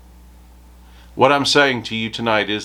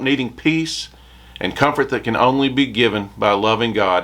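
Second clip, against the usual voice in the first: normal talking voice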